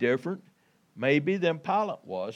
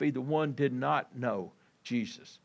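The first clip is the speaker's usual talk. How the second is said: normal talking voice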